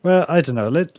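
ordinary talk, nothing marked

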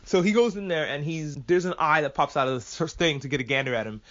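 ordinary talk, nothing marked

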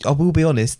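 ordinary talk, nothing marked

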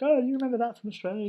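high, quick, chirpy Aussie question; a high, fast mock of Sky's surprised question; imitating Sky. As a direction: pitch it up high and fast like the boss teasing. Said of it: "And she'd be like".

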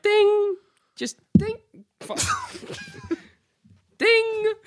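high-pitched, bright little bird call ding; a playful high bird ding between giggles; bird chirp imitation. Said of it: "it was like".

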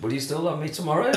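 "tomorrow?" rises clearly at the end; "will you still love me tomorrow?" lifted like a real question; rising question. Shown as a demonstration. Playing [name] in bed, unable to stop it sounding like a question. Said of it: "really hard not to make it a question".

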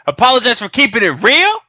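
loud, high, scoffing question; incredulous outrage. Real, not acted. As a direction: throw the idea back as absurd. Indignant echo of the apology question.

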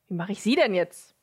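ordinary talk, nothing marked

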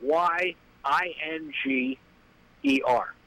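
slow letter by letter, rising on the last letters; spelling it out. Shown as a demonstration. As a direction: spell out each letter slowly. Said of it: "I'll spell it for you".

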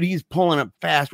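usual speaking voice

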